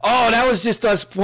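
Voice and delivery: put-on kid's excuse. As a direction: play the kid brushing it off. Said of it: "And the kid goes".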